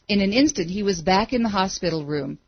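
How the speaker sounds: ordinary delivery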